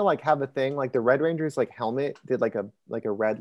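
normal talking voice

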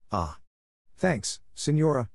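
cried out. A narrator's reading. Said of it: "cried Marco".

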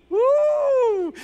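long high "woo"; excited whoop. Real, not acted. Preacher celebrates God's promise to congregation, excited whoop. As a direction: let out a drawn-out, high pulpit whoop.

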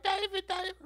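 very high-pitched mock scream; imitated screaming cousin. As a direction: squeal it like a tattling cousin. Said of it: "she went inside screaming".

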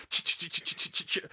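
soft voiceless chattering "ch-ch-ch"; ticker tape noise. Retold or imitated. Host imitating ticker tape coming out, whispered chugging sound. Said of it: "your ticker tape comes out".

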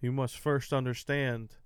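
slow, solemn proverb voice; slow wise quote. Acted. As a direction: deliver it like an old master. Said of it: "I got a quote".

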